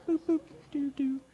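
high beeping video game blips; Pong sound effects. Retold or imitated. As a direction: chirp it like an old Atari game. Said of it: "Sound effects".

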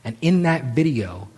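ordinary talk, nothing marked

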